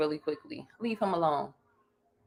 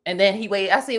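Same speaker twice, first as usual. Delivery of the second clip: ordinary talk, nothing marked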